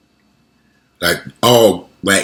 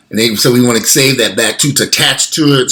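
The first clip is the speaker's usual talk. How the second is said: ordinary talk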